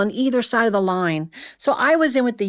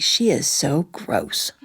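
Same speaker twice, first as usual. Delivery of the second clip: disgusted thought; 7 semitones lower, slower than usual. Retold or imitated. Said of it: "And I'd think".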